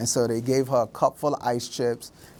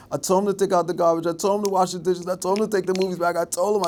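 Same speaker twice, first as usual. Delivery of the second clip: higher-pitched imitation of a scolding mom; nagging mother; 6 semitones higher, faster than usual. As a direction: rattle off complaints like a nagging mother. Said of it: "I heard my mother's voice".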